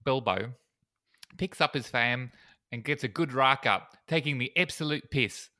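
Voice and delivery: hammed-up Kiwi vowels; bad New Zealand accent. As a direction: badly imitate a New Zealander. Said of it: "the terrible New Zealand thing".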